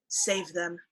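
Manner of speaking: airy, soft ghostly rasp; raspy breathy voice. Acted. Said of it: "you hear in a very raspy, very breathy, airy voice".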